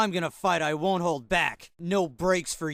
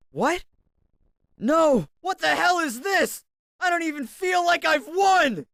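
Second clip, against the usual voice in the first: shocked disbelief; 13 semitones higher, 3 dB louder than usual. Acted. Shocked after a hollow victory.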